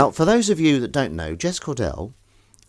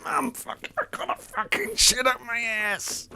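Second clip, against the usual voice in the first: much higher squeaky creature voice, slow; squeaky seal voice; 3 dB quieter, slower than usual. Pretend. Squeaky plate voiced as a constipated seal calling mum. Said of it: "Sounds like a little seal".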